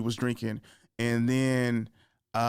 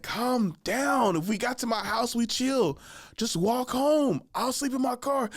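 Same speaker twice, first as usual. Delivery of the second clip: put-on higher, drawn-out drunken protest; pleading for calm; 10 semitones higher, slower than usual.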